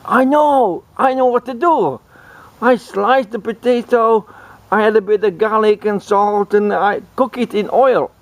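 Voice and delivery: high-pitched excited cook voice; excited French cook. Acted. French cook announcing his potato recipe, excited. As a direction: pitch it way up, thrilled with the idea. Acting the French cook's eureka.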